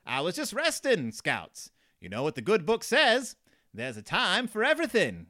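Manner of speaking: high, loud, hammed-up farmer; Farmer Ben voice. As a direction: preach it in a cartoon farmer voice. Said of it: "That's my Farmer Ben voice".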